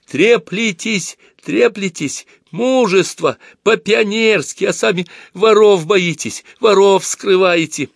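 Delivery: high, drawn-out angry accusations; выкрикивает в слезах. A narrator's reading. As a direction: hurl it out hysterically, slow and high. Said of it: "заливалась слезами и выкрикивала". (истерически выкрикивать обвинения)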